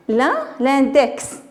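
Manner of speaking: slow "index" ending in ks; final X pronounced. Shown as a demonstration. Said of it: "on entend X". (index lentement, X final prononcé)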